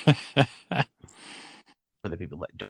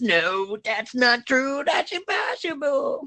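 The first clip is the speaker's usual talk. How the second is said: rising to a much higher, thin wail; hammed-up Luke crying impossible; Luke's wail; 9 semitones higher than usual. Retelling the quote after the reveal as wailing Luke. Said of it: "the quote after that is".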